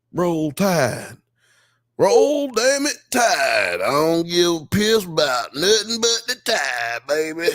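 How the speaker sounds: high, hammed-up Southern football holler; Southern accent. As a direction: whoop it like a rowdy Southern fan. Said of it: "all of your Southern accents".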